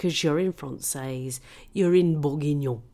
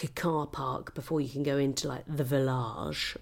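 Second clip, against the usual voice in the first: ordinary delivery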